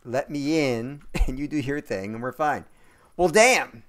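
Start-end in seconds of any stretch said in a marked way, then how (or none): 3.2-3.8 loud, high burst of surprise; surprised exclamation. Real, not acted. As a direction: shout it out in disbelief. Surprise at triple kill.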